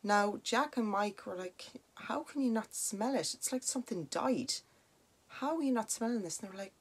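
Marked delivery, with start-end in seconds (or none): 2.0-6.4 higher-pitched, disbelieving questioning; incredulous. Retold or imitated. Friends who smell something rotten question the others, incredulous. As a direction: press them in disbelief, voice raised in pitch.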